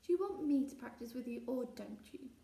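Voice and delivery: ordinary delivery, nothing marked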